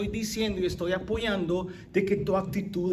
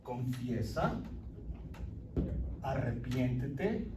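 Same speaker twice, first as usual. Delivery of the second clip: much lower and softer, slow call to repent; soft low urging; 8 semitones lower, 8 dB quieter, slower than usual. Retold or imitated. Preacher voicing the Holy Spirit urging repentance, low and soft. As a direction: murmur it like an inner voice.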